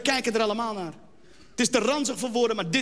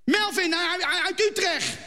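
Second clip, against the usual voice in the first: higher, slow, nervous Utrecht lad with a laugh; a shy Utrecht boy stammering his name, higher and slow; 6 semitones higher, slower than usual. Acted. Nervous boy introduces himself to jury, higher Utrecht accent. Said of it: "een Utrechtse jongen".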